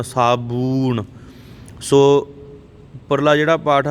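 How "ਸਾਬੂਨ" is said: ਸਾਬੂਨ slow, second vowel held; long OO. Shown as a demonstration. Teacher repeats for learners, ਸਾਬੂਨ with long oo. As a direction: model ਸਾਬੂਨ as saa-boooon. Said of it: "ਬੱਬੇ ਦੇ ਲੈਂਕੜੇ ਨਾ".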